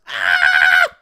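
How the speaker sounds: loud shocked screech; a sudden startled shriek. Acted. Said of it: "she starts screeching".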